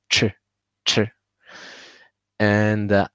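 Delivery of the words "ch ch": Ch with a strong puff of air; 送气音 ch; aspirated ch. Shown as a demonstration. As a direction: push air out on the ch. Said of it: "has a lot of air".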